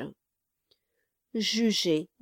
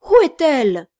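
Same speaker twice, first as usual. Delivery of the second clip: loud, higher, anxious cry; anxious question; 5 semitones higher, 10 dB louder, faster than usual. Acted. Bride rushing to the door, anxious and loud. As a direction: burst out asking. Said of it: "dit la dame".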